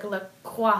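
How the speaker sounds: LaCroix said "luh-KWAH"; French-style pronunciation. Shown as a demonstration. Host who took French guessing for co-hosts, LaCroix as "luh-KWAH". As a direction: turn the end of LaCroix into "kwah". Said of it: "how would you pronounce the O X".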